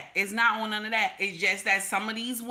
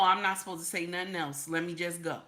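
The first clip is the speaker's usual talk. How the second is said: low, soft, quick self-talk; resigned king; 5 semitones lower, 6 dB quieter, faster than usual. Acted. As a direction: back off quietly, as if thinking to yourself. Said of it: "a king already know".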